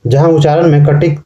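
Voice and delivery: ordinary delivery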